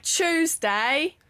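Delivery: mock Cockney "Tuesday"; London street accent. Shown as a demonstration. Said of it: "the one where it's like".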